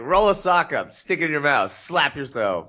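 lightly acted high-pitched pep command; mock app reminder. Podcaster voicing a meditation alarm's advice, mock app reminder. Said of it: "It's like".

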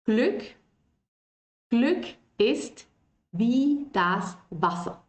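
Glück then wie das Wasser drawn out slowly; model for repeating. Shown as a demonstration. Teacher inviting students to repeat, slow and clear. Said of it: "Ustedes les invito a que repitan la frase".

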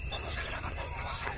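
hushed whisper; whispering while hiding. Real, not acted. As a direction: whisper low. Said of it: "No, no puedo hablar fuerte".

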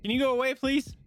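high, loud scared plea; scared pleading. Real, not acted. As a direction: beg it to go away. Pleading with game threat.

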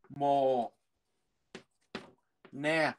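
"Mồ nè" said as two separate syllables, the d silent; teacher spacing out "Mồ" then "nè"; spelled-out pronunciation. Shown as a demonstration. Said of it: "Âm đê câm nha".

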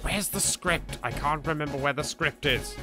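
ordinary talk, nothing marked

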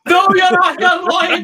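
loud, high cry of disbelief; can't believe the good news; stunned disbelief. Real, not acted. Billy hearing he's Scottish, loud shocked disbelief.

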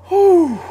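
a high, loud whew of relief; high relieved whew. Real, not acted. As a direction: blow out the fear. Relief after escape.

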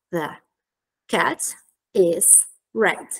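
very slow, a pause after each word. Shown as a demonstration. Teacher giving the model answer, word by word. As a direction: separate THE, CAT, IS, RED completely. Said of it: "questa è la risposta".

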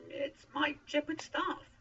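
high, loud, drawn-out reply; nervous answer. A narrator's reading. Moses tells God what he holds, nervously.

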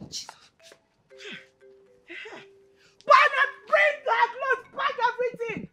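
furious shouted orders; mother yelling to pack her clothes; angry scolding. Acted. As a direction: throw the girl's things out in a rage. Mother angrily ordering clothes packed.

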